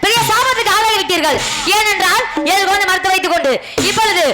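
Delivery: high, ringing declamation; impassioned stage-king oratory; royal curse. Acted. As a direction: pronounce doom on the minister. King pronounces curse on minister.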